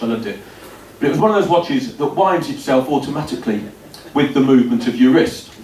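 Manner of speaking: ordinary talk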